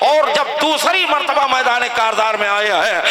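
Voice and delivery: impassioned preaching. Acted. Preacher voices nephew and uncle before the last battle, impassioned preaching. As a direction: carry the dialogue in a ringing preacher's pitch. Preaches the dialogue at full force.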